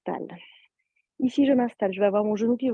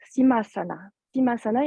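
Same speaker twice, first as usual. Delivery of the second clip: ordinary talk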